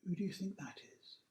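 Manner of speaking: plain reading voice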